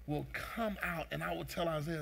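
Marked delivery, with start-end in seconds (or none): none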